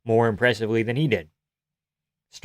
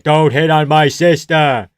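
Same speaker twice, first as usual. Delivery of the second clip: slow, louder threatening Italian tough guy; mock angry brother; 3 semitones higher, 8 dB louder, slower than usual. Italian guy warning the Irish guy off his sister, mock angry. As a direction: lean in and warn him off, slow and loud. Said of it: "an Italian person would beat up an Irish person and be like".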